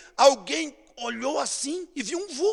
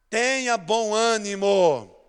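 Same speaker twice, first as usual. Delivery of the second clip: slow call-and-response line; 2 semitones lower, much slower than usual. Shown as a demonstration. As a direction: say it slowly for the church to repeat. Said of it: "Diga comigo assim". (frase lenta para a igreja repetir)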